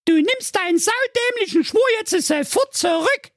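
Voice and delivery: sehr hohe, gerufene Forderung; brüll ihn empört an; Prinzessin schreit. Acted. (die Tochter fordert schreiend die Rücknahme des Schwurs)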